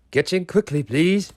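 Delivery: lifted, eager call to passengers. A narrator's reading. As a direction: hurry them along brightly.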